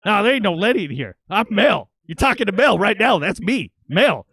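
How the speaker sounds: high, loud insistence; dad telling the stranger his name; annoyed dad. Retold or imitated. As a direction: set him straight, loudly. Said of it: "my dad's like".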